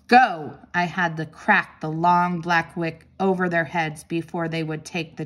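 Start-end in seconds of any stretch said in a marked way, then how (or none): 0.1-0.6 loud sharp command; yelled. A narrator's reading. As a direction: bark the word at the dogs. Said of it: "when I yelled".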